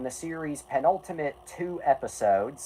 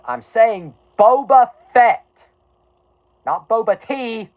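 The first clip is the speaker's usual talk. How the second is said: loud, slow, pressing on Boba Fett; emphatic correction; 9 semitones higher, 8 dB louder than usual. Real, not acted. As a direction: set the record straight, firmly. Correcting a mishearing.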